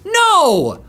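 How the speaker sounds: shocked no. Real, not acted. Shocked reaction to news.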